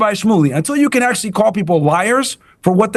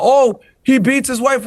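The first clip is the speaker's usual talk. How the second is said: mocking the rabbi; 6 semitones higher, slower than usual.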